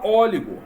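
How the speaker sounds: "óligo" with stress on an open first O; accented open O. Shown as a demonstration. Teacher showing what the added accent should produce, stressed open O. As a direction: hit the open Ó at the start of "óligo". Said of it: "bota um acentinho aqui".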